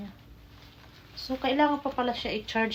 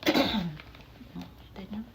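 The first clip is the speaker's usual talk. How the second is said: whispering; 3 semitones lower, much slower than usual. Real, not acted. Whispered reveal before unboxing.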